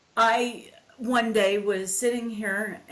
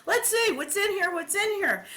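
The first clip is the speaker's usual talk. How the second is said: much higher, manic Robin Williams impression; quoting Robin; 10 semitones higher than usual. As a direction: go up high and wild like Robin. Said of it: "he's going".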